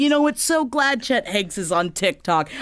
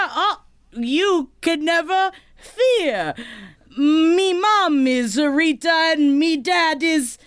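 higher, slow sing-song rhyme; 8 semitones higher, slower than usual. Pretend. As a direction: recite it like a cocky nursery rhyme. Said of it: "he only ever speaks in rhyme".

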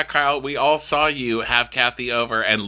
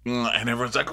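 ordinary delivery

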